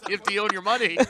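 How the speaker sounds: high, loud, through laughter; laughing. Real, not acted. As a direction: blurt it out mid-laugh. Laughing while teasing viewers.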